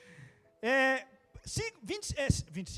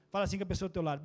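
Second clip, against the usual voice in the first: ordinary delivery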